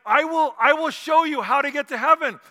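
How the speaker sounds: lightly acted evangelist's emphatic promise; Billy Graham. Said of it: "And so Billy Graham says".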